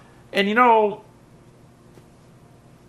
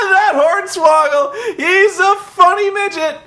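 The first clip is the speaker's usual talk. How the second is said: high, loud, slow fake-jolly announcer; mock forced cheer; 6 dB louder than usual. Mocking Cole forcing praise for Hornswoggle. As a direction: pretend the unfunny bit is hilarious. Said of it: "Cole's like".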